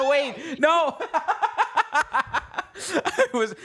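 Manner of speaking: giggling through the words; high, breaking into laughter; laughing. Real, not acted. Laughing at clip.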